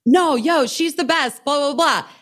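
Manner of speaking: high, emphatic driver impression; driver's insistent vouching. Driver telling clerk she's the best, high and insistent.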